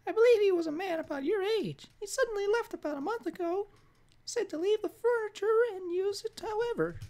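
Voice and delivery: high-pitched cartoon old lady; Mickey Mouse voice. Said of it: "why do I sound like Mickey Mouse".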